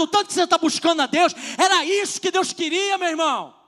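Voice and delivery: loud, high preaching at full force; preaching shout. Retold or imitated. Pastor preaching back at the rebuking friend, loud and fiery. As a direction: preach it hard at him. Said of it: "Eu falei pra ele".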